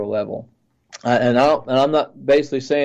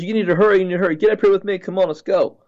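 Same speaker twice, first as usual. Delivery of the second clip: mock urgent yell; 6 semitones higher, faster than usual. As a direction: hurry them along in a raised pitch. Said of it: "he yells and says".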